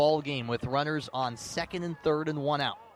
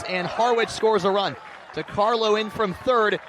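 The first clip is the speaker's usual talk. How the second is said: loud, high urgent call of the wild pitch; excited shout; 4 semitones higher, 5 dB louder than usual. Real, not acted. Announcer calling the go-ahead run to listeners, excited shout. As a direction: shout it as the run crosses the plate.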